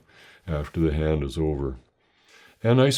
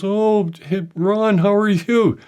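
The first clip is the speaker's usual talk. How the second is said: gushing cashier; 11 semitones higher, 5 dB louder than usual. Retold or imitated. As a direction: play the cashier suddenly thrilled to see an old friend. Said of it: "she goes".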